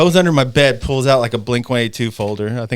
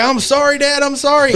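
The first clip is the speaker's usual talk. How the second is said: much higher, whiny sorry; mock pleading son; 13 semitones higher than usual. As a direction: play the caught kid begging forgiveness.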